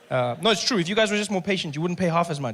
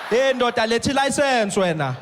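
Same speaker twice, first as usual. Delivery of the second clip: louder, higher, slow; loud cop demand; 4 semitones higher, 7 dB louder, slower than usual. Retold or imitated. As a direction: bark the order at the driver. Cop insists.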